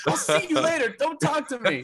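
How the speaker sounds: very high, laughing send-up; mock huffy goodbye. Walking shoulder to shoulder with his rival, high laughing mock huff. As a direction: squeal a mock brush-off through laughter.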